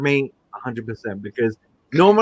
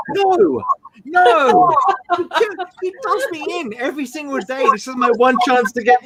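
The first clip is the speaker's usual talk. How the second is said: indignant protest; 8 semitones higher than usual. Retold or imitated. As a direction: insist you're the real victim here. Protesting the fans' pity.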